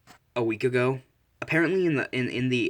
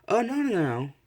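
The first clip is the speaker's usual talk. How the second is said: much higher, dismayed string of no's; acted dismay; 10 semitones higher, 3 dB louder than usual. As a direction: cry out in mock dismay, pitch leaping up. Sister character reacts to forgotten birthday.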